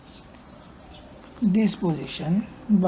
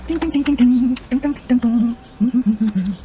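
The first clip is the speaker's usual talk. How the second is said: sound effect; 5 semitones higher, 3 dB louder than usual. Pretend. Mimicking a sound while fitting.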